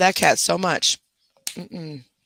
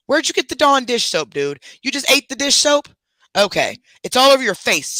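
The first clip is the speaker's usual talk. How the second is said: incredulous scolding; 8 semitones higher than usual. Real, not acted. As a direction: demand answers from the dog. Scolding dog over soap.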